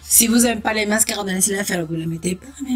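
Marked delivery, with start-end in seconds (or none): none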